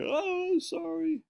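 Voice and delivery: very high squeaky mock oh sorry; embarrassed apology. Snob classmate realizing he is from Portland, squeaky high mock apology.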